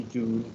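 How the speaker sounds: "doon" drawn out slowly with a long oo instead of ow; Geordie doon. Shown as a demonstration. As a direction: stretch "doon" with a long rounded Geordie vowel. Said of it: "down would be doon".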